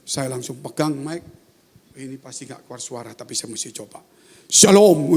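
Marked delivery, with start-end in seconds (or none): 4.5-5.1 loud, ringing greeting; re-enacted sudden full voice; voice bursting out. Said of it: "Keluar saudara".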